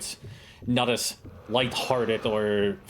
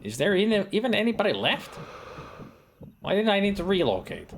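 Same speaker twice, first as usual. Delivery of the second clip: surprised; 10 semitones higher than usual. Real, not acted. Baffled that no enemies remain.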